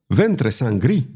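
ordinary delivery, nothing marked

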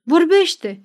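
sharp rise in pitch and volume; threatening demand. A narrator's reading. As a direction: order him to talk, threateningly. Threatens the servant.

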